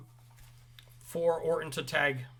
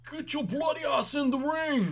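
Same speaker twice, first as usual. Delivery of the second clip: high, mock British yell; mock British accent, shouted high; 11 semitones higher than usual. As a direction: bark it like a Scottish wrestler. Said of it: "You finally did the accent".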